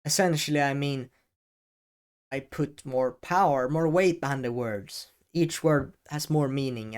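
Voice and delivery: ordinary talk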